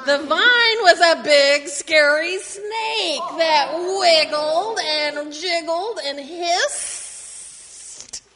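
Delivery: slow, drawn-out scary storytelling; spooky. Acted. Reading to kids, slow and spooky about the snake. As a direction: make the snake sound creepy.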